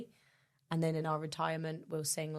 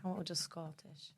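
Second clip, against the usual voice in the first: attempted Scottish accent; 10 dB quieter than usual. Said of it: "what accent would you like".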